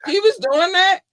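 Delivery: high, loud disbelief; shocked. Real, not acted. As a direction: gasp out the question in shock. Shocked question.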